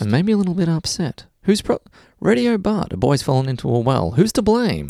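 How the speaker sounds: high, upset talkback host; AM radio voice. Shown as a demonstration. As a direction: play a worked-up talkback host. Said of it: "do it in our A M radio voices".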